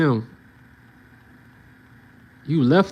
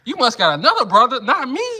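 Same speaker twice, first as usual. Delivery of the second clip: rising into a high, crying whine; whiny denial; 6 semitones higher than usual. Pretend. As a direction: beg to be left out. Imagined brother denying.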